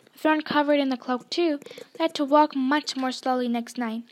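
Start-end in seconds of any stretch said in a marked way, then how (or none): none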